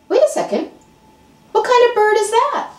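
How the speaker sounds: high, puzzled exclaim; surprised friend. Acted. Friend spots the strange bird, high surprised voice. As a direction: friend squints up in disbelief.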